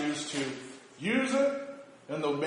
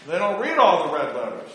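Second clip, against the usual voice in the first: normal talking voice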